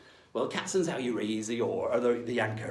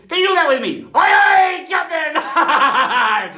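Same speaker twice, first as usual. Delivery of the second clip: high, loud shouted "Aye, aye, Captain"; rally the crew at full voice; pirate shout; 13 dB louder, much slower than usual. Acted. Teacher rousing students to answer the captain, shouted. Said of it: "rousing class to reply".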